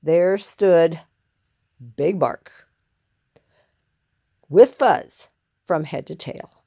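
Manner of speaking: ordinary delivery, nothing marked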